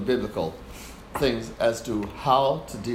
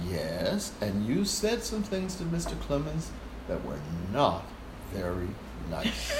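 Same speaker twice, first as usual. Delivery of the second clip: softer, measured and steady; calm firm Fred; 3 semitones lower, 8 dB quieter, slower than usual. Retold or imitated. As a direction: quietly lay down the law. Fred calmly confronts conductor.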